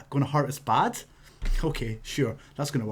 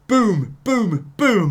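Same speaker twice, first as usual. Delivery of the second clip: punchy imitated impacts, louder; loud mock chop sounds; 6 semitones higher, 6 dB louder, much slower than usual. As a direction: hammer out each chop. Imitating more chops.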